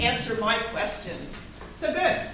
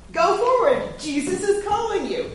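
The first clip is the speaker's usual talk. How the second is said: eager call; 7 semitones higher than usual. Shown as a demonstration. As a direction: call out brightly to send him forward. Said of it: "Then your job is to say".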